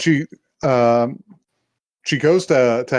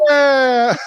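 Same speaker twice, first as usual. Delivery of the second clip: high, loud imitation of an excited kid; delighted cheer; 11 semitones higher, 6 dB louder than usual. Little brother hoisted up by the squid girl, mock delighted cheer.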